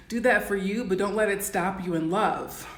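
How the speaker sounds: usual speaking voice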